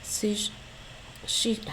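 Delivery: stumbling through a mouthful; trying to talk while chewing; mouth full. Real, not acted. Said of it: "I have food in my mouth".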